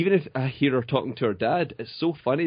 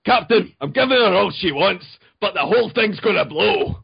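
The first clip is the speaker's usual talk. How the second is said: high, loud frantic cry to the captain; excited line reading; 8 semitones higher, 6 dB louder than usual. Acted. Guest repeats the host's line for a prize, over-excited and loud. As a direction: play it big, pitched up and urgent. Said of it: "try to sound a little bit more excited".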